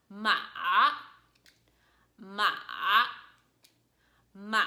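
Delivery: "Ma" dipping down then back up; dipping "ma" with a break at the bottom; third tone. Shown as a demonstration. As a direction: bend "ma" in a V shape. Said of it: "movement of down and up".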